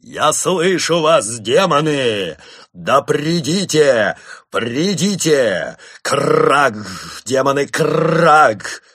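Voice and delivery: a monk exulting as demons approach; joyful exclamation. A narrator's reading. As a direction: cry out in triumph to the demons. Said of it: "радостный возглас брата Немрода".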